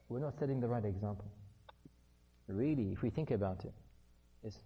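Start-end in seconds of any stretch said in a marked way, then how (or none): none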